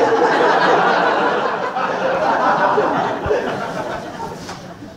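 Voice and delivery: long high airy laugh; real wheezing laugh. Real, not acted. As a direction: collapse into laughter. Laughs at own joke.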